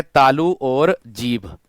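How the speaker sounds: तालु और जीभ drawn out word by word; teacher stressing the key words; slow emphatic answer. Shown as a demonstration. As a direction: spell the answer slowly for students. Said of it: "वो किससे है?".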